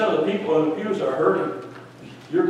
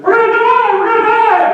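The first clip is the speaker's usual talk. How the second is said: high, loud terrified cry; panicked scream; 8 dB louder than usual. Retold or imitated. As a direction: shriek it like sailors about to drown. Disciples panic in the storm.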